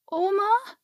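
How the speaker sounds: put-on timid, high questioning child; zaghaft. A narrator's reading. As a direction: ask it hesitantly in a high little voice. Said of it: "fragte sie zaghaft". (zaghaft fragend, mit hoher Stimme)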